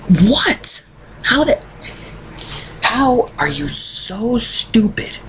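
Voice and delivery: high, incredulous outburst; exasperated disbelief. Real, not acted. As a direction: drag out the words in baffled outrage. Ranting about the filmmakers' casting choice.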